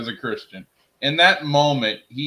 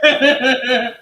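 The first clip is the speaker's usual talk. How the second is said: high, loud laughing question; 13 semitones higher, 8 dB louder than usual. Real, not acted. Teasing friend about his name, laughing loudly. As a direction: crack up mid-question.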